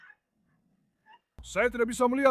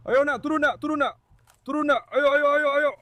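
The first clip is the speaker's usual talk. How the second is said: high, loud coaxing call to the birds; calling pigeons; 6 semitones higher, 5 dB louder than usual. Real, not acted. Farmer calling his pigeons down, high and loud.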